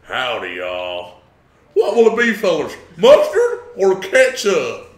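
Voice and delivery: put-on loud, high American cowboy accent; hammed-up American diner cowboy accent. Asked for an American accent, hammed-up loud American. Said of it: "American accent".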